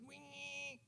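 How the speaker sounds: high, thin outboard motor noise; tiny engine whine. Retold or imitated. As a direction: draw out a high, soft "wee" for the little boat. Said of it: "like, a fifteen horsepower engine, really small".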